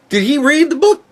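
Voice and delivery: incredulous question. Real, not acted. Questioning the director.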